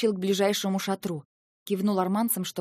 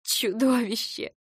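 sobbed insult; 3 semitones higher, slower than usual. Acted. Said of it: "всхлипнула я".

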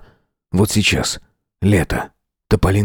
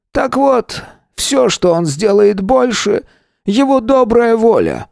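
grandfather voice; 12 semitones higher, slower than usual. Acted.

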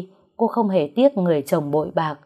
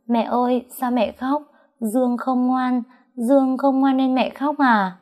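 put-on small child anxious she caused the tears; worried little girl; 6 semitones higher than usual. A narrator's reading. Little daughter asking her crying mother, high and worried.

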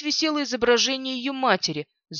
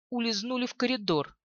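ordinary delivery

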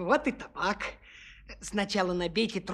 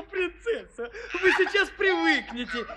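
higher, laughing through the words; laughing Espen; 7 semitones higher than usual. Acted. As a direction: gloat while she coughs, chuckling. Espen laughing at coughing princess.

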